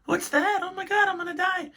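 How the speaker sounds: put-on panicked old person. Old people watching the ad, panicking about dying. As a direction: wail it like a terrified old lady. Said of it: "I could picture all these old people going".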